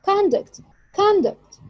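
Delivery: "conduct" said as CON-duct, falling off after CON; stress on first syllable. Shown as a demonstration. Teacher repeating the noun conduct, stressed on the first syllable. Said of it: "as a noun".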